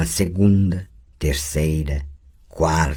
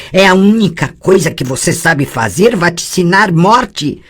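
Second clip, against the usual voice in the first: high, loud, angry lashing out; furious outburst; 12 semitones higher, 7 dB louder than usual. Acted. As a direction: hurl the accusation back.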